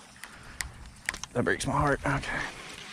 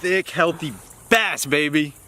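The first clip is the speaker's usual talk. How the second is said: drawn-out excited gloat; 4 semitones higher, slower than usual. Real, not acted. Admiring the caught bass.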